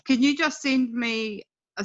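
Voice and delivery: ordinary talk, nothing marked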